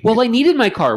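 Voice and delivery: high, loud whiny excuse; mock customer excuse. Coworker mimicking a customer's excuse, high and whiny. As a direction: whine it like a caught customer.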